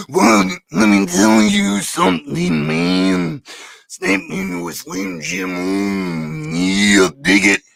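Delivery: slow, gravelly, drawn-out hype voice; Macho Man impression. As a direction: growl it out like a wrestling promo. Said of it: "do your Macho Man".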